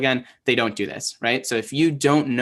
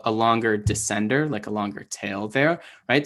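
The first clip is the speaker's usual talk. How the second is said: ordinary delivery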